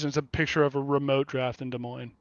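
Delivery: normal talking voice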